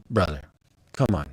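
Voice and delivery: low, soft, knowing "come on"; exasperated aside. Real, not acted. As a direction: drop the voice, like telling a buddy not to fall for it.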